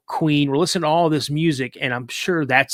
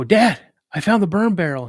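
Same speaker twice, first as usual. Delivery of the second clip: raised, higher call to someone off away; 6 semitones higher, 6 dB louder than usual. Retold or imitated. Son calling his dad over to the woods, calling out. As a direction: imitate a kid hollering for Dad.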